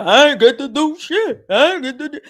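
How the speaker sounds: the voice he doesn't really have, gruff and pushed; wrong voice for him. Retold or imitated. Storyteller mocks the husband refusing to pay, in a tough-guy voice. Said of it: "He doesn't talk like that".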